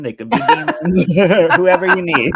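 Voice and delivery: laughing through the words. Real, not acted. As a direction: giggle through the joke.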